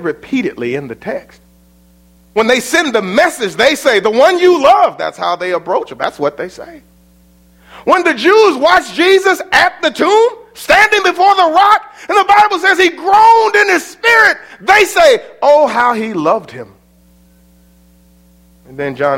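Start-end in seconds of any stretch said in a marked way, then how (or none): 2.4-5.0 climbing higher and louder toward the quote; rising preaching. Acted. Preacher builds to a climax. 7.8-16.7 high, loud shouted preaching; preaching at full force. Acted. Preacher shouting the tomb scene.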